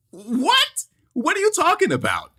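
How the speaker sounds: very high-pitched mock disbelief; incredulous. Actor stunned by director's remark, high incredulous squeal. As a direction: squeak it out in hammed-up bewilderment.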